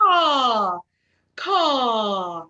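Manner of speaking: loud open caw like a crow, tongue flat and jaw dropped. Shown as a demonstration. Vocal coach demonstrating the crow sound, loud caw. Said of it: "Do it again".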